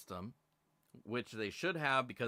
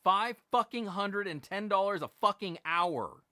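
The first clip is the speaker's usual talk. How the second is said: louder and higher, furious outburst; angry repeat; 8 semitones higher, 7 dB louder than usual. Real, not acted. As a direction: shout the price in disbelief. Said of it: "I'm gonna say it again".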